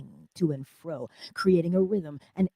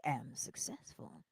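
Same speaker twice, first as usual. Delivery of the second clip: slow whispered I am successful; 5 semitones lower, 14 dB quieter, slower than usual. Shown as a demonstration. Teaching how not to say I am successful, whispered. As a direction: breathe it out like a secret. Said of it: "you better say it like you mean it".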